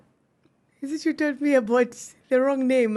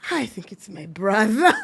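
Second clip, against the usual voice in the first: ordinary delivery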